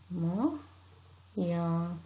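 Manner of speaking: normal talking voice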